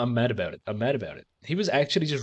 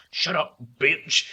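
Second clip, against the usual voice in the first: slow, mock-tough Rock snarl; Rock impression; 5 semitones higher, much slower than usual. Streamer imitating The Rock for chat, slow mock snarl. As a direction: drag it out like a movie tough guy. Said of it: "for imitating The Rock is".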